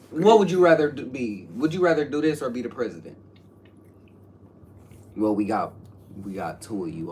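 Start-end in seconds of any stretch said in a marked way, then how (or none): 0.1-3.2 loud. Real, not acted. Teasing friend, loud sudden question.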